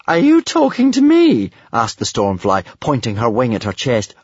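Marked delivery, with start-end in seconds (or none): 0.1-1.5 very high, mock-surprised voice; coy dragon. A narrator's reading. Stormfly pretends she wasn't addressed, coy and high. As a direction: ask it high, as if astonished.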